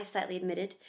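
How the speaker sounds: plain reading voice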